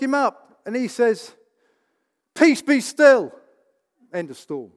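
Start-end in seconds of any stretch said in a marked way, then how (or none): 2.3-3.3 commanding call. Retold or imitated. Jesus quoted calming the storm.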